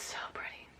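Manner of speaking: breathless whispered admiration; awed whisper. Real, not acted. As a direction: whisper in awe. Admiring glitter.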